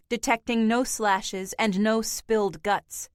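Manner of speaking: plain reading voice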